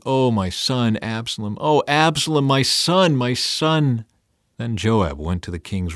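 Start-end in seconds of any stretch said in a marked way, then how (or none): none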